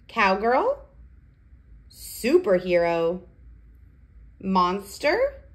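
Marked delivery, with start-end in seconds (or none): none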